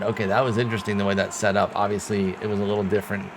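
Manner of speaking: ordinary delivery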